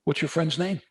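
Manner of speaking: high upbeat officer; mock cheerful cop. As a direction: play the cop suddenly pleased. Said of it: "He said".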